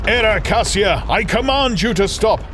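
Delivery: high, loud ringing command; a brother's high, loud command to halt; commanding cry. Acted. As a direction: order her to stop with full force. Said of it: "Murloc cried out".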